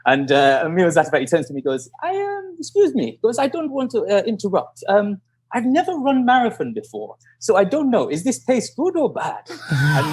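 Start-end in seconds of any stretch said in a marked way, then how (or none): 2.0-9.5 high, hesitant foreign-sounding voice; imitated polite runner. Runner asking his rival about pace, imitated high and polite. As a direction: interrupt apologetically in a much higher voice.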